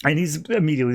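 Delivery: ordinary talk, nothing marked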